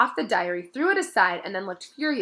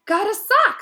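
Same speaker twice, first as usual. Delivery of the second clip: much higher, louder squeaky elf; elf in disbelief; 14 semitones higher, 9 dB louder than usual. A narrator's reading. Dobby in disbelief that he got a sock. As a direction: gasp it out, astonished. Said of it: "in disbelief".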